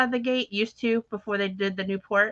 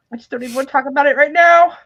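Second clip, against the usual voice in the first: loud, fast, higher-pitched outburst; mock exasperation; 6 semitones higher, 10 dB louder, faster than usual. Refusing to discuss the keys, in loud mock exasperation. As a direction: cut off the subject in a retold huff.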